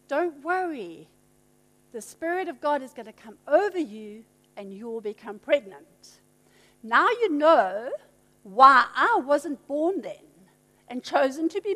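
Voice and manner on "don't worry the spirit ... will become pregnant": calm her fears gently, quietly; reassuring angel. Acted. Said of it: "And the angel says".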